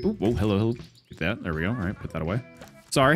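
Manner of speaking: usual speaking voice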